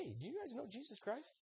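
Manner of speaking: high, soft, chirpy hail; cheery witnessing. Acted. Friend walks up to East German guards, cheery high innocent voice. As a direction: pipe up innocently, high and light.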